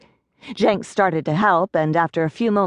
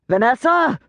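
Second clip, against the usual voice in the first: louder, higher angry call; irate shout; 8 semitones higher, 4 dB louder than usual. A narrator's reading. Boss shouting for his secretary, louder and angry. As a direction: yell her name from the back office. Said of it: "came an irate shout".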